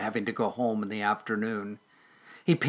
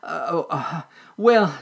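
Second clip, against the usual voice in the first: high, halting nervous stammer; nervous stutter; 5 semitones higher, 6 dB louder than usual. Acted. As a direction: stumble over the words, scared. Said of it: "stuttered a nervous Squeakers".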